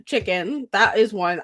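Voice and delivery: ordinary delivery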